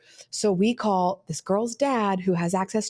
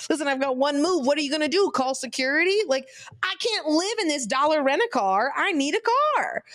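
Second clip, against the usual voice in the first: defiant; 7 semitones higher than usual. Retold or imitated. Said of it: "I'm like".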